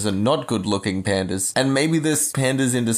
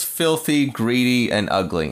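ordinary talk

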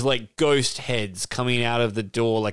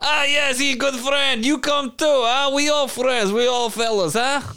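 put-on higher, broken English with a foreign accent; an excited accented dragon inviting friends; 10 semitones higher than usual. A dragon urging the player to bring the singer, excited foreign accent. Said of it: "That's a perfect dragon accent".

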